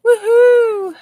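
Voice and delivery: high loud woohoo; joyful cry. Real, not acted. Streamer cheering milestone to chat, high loud woohoo.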